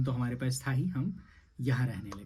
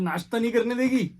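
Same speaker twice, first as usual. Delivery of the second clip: mock scolding; 10 semitones higher, 7 dB louder than usual. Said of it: "मैंने कहा".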